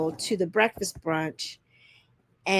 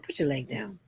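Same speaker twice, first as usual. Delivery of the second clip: low, softer disgusted order; imitated disgust; 8 semitones lower, 9 dB quieter than usual. Man telling woman in the car, understated mock disgust. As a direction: repeat his disgust quietly, pitched down. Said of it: "He was disgusted".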